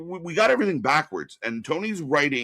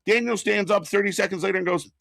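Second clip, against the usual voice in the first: ordinary talk, nothing marked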